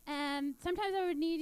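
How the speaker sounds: ordinary talk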